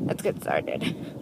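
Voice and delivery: low slow whisper. Real, not acted. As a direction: hush it, barely voiced. Whispered restart while hiding.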